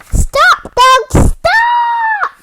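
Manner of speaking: high, loud shout of stop. A narrator's reading. Parent reading to a child, shouting stop to the dogs. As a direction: yell it like halting the traffic. Traffic light stop in story.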